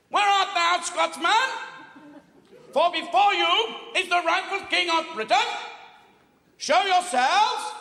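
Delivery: high, loud pompous royal challenge; haughty English king. Acted. English king challenging a hidden Scotsman, high pompous proclamation. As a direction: call out high across a battlefield, grandly. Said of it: "he stood at the border and he said".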